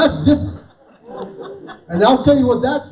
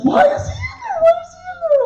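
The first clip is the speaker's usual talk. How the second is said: very high shrill panic; panicked shriek; 3 dB louder, faster than usual. Retold or imitated. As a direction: shriek it in horror at the toilet. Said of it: "She goes".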